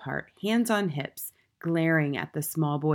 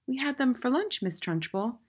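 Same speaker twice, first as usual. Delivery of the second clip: put-on high little-boy voice; high-pitched, unruffled schoolboy; small boy, reasoning; 7 semitones higher than usual. A narrator's reading. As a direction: explain innocently in a high child's voice.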